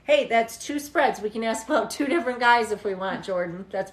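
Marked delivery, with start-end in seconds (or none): none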